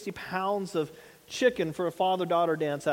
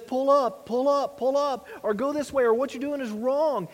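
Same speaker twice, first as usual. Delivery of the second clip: conscience warning; 6 semitones higher than usual. Retold or imitated. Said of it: "It says".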